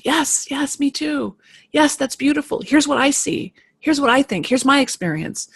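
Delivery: high, bright mock-eager chorus of replies; voicing the group piling on with support, high and eager. For the class, eager high imitation of supportive replies.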